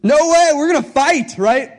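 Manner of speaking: Peter protesting. Retold or imitated. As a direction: push back hard, ready to fight. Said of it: "Peter's like".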